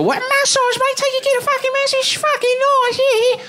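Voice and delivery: little Aussie promoter in a tiny high voice; tiny excited Australian promoter voice; Australian accent. Retold or imitated. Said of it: "this little Australian guy".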